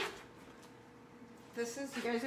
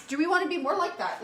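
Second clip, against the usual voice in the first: louder, higher and faster, pushing the question; emphatic repeat; 6 semitones higher, 5 dB louder, faster than usual. Real, not acted. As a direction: hit it again with more energy.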